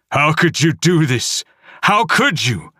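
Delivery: lightly voiced furious dad; angry father yell. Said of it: "Dad yells".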